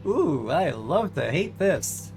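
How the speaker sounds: slow, savoring relish; gleeful dread. Acted. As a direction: draw it out with relish. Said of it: "savoring the dread".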